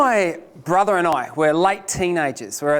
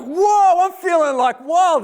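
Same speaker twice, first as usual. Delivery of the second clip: high, loud hyped whoa; riding the pep talk buzz; pumped up; 10 semitones higher, 4 dB louder, slower than usual. Retold or imitated. After the operator's pep talk, high and pumped up.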